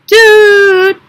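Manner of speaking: long, high, loud held doot; tekiah trumpet blast. Shown as a demonstration. Teacher demonstrating the travel signal, long trumpet blast. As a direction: sound a steady horn note. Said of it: "Here's how it's gonna sound".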